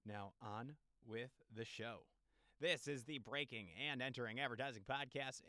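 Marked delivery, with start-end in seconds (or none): none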